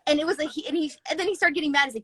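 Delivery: ordinary delivery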